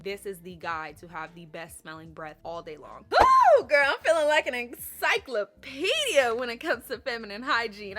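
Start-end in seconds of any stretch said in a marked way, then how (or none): 3.1-8.0 bursting with excitement, loud and high; excited. Real, not acted. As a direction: hype the audience up. Excited vlog opener, big burst.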